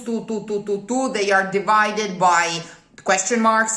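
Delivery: ordinary talk, nothing marked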